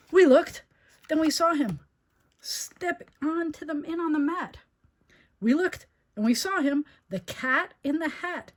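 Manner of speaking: high, loud astonished reading; gasping surprise. A narrator's reading. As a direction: gasp and reveal the cat. Gasps at cat's arrival.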